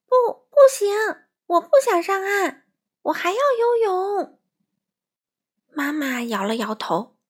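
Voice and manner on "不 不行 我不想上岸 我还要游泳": very high, loud refusal; protesting child. A narrator's reading. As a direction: protest loudly like a stubborn child. Child refuses mother's order. (孩子高声抗议)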